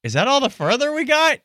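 brother-in-law puzzling over a weak throw, mock bewildered; mock puzzled builder. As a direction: play the baffled builder. Retelling confused trebuchet builders.

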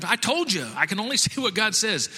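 high-pitched, acted protest; exasperated self-defence. Balaam defends himself to Balak.

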